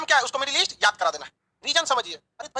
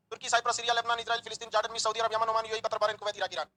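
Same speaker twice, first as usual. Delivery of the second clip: rattled off in a single breath, a bit softer; one breath; 2 semitones lower, 6 dB quieter than usual. Shown as a demonstration. Teacher showing the class the list in one breath. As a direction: race through the whole list without pausing. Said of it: "एक सांस में खत्म हो जाता".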